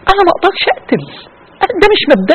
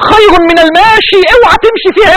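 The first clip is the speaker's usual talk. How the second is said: tearful warning; 6 semitones higher than usual. Real, not acted. Preacher begging listeners to stay out of strife. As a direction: plead through the tears. Emotional warning, crying heard.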